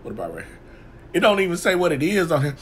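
normal talking voice

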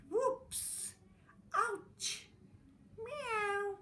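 high, yelped whoops, ouch and meow; mock falls and cries. Everyone tumbles down, squealing high mock cries. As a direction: squeal each cry like a tumbling animal. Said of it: "Everybody went".

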